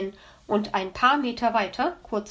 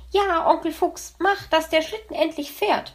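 higher, eager little voice; pleading child; 8 semitones higher than usual. Acted. Little fox Weißohr begging his uncle, higher and eager. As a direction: beg Uncle Fox to start. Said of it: "bat Weißohr".

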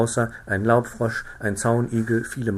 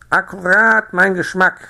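high, loud, croaking frog delight; quacking frog; 9 semitones higher, 8 dB louder than usual. A narrator's reading. Frau Knack quacks her approval of the doughnuts. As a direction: quack it out gleefully. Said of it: "quakte die Frau Knack".

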